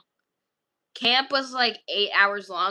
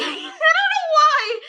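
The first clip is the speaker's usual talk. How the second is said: squealing laugh, much higher and louder; 7 dB louder, much slower than usual. Real, not acted. Laughing at her own joke for the viewers. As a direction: break into laughter mid-line.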